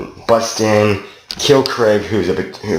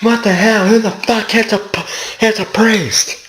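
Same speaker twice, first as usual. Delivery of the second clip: mock outrage. Storyteller quoting a shocked character in the game, mock outrage. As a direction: blurt it high, as an incredulous imitation.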